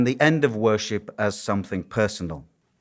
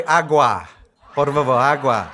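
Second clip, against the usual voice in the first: put-on lightly Spanish-accented "agua, por favor, agua" with a laugh; amused, half-laughing Spanish request; Spanish words; 4 dB louder than usual. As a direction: switch to Spanish to get through. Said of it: "I had to say".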